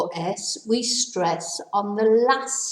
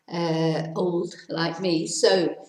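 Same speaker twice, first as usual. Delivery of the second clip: normal talking voice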